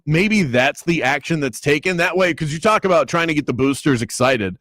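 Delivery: usual speaking voice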